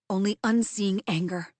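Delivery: plain reading voice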